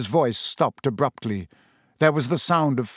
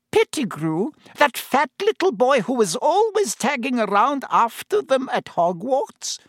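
much higher, lightly voiced barmaid asking in disbelief; higher landlady voice, mildly astonished; surprised landlady; 12 semitones higher than usual. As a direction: recall the boy with gossipy surprise. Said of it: "said Madame Rosmerta".

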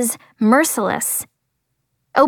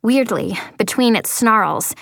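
ordinary delivery, nothing marked

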